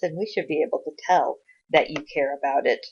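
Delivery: ordinary delivery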